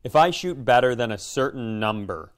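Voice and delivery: ordinary delivery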